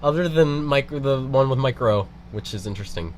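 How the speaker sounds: ordinary delivery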